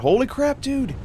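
sudden high yelp of surprise; real shock, pitch jumping way up; startled exclamation. Real, not acted. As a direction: blurt it out, caught off guard by the dino. Huge dino blocking the road.

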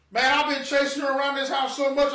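ordinary talk

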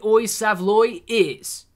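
slow "oi, Savloy", testing the sounds; trying out the phrase. Shown as a demonstration. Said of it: "I don't know what oi".

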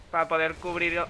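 normal talking voice

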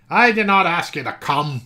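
grumpy uncle shouts at his niece; shouting. Acted. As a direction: snap it at the unwanted guest. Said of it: "he shouted".

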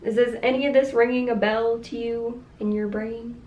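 ordinary talk

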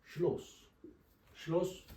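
Schloss with a short clipped o. Shown as a demonstration. Teacher shows the short o in Schloss. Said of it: "Bu kısa okunuyor".

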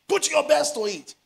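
high, loud call to give your best; rally them on every word; exhorting shout. Real, not acted. Preacher exhorting the church.

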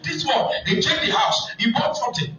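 high, excited preaching; animated sermon story. Acted. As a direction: tell the tale with rising heat, high. Preaching story at full force.

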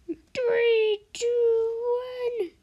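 high, loud countdown; countdown shout. Real, not acted. Race countdown again.

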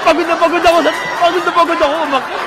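loud, high mock tirade; hammed-up exhausted outrage, voice pushed up; angry old person. Exasperated old person berates the helper after climbing the overpass, loud mock anger. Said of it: "galit na galit ang matanda".

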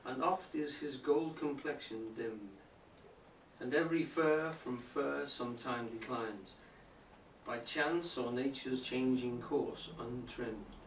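slow, measured sonnet recital; poem reading. Acted. Teacher reading a sonnet aloud to the class, measured recital. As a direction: read each line with weight and care.

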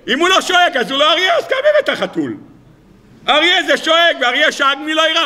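high, animated comic argument; mock indignation. Teacher arguing that a lion has to roar, comically indignant.

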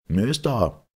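loud, high, menacing challenge into the dark; threatening call. A narrator's reading. Jameson calls threateningly down to the stranger. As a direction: bark it down at the street like a guard. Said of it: "rief er drohend".

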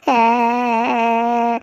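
drawn-out fake crying wail. Boy fakes a long wail to frame the seller. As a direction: wail like a child to draw a crowd.

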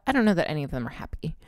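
ordinary talk, nothing marked

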